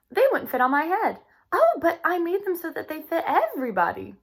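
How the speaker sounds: lively read-aloud storytelling voice; reading to children. A narrator's reading. Teacher reading a storybook to her class, lively. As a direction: read the chapter brightly to young listeners.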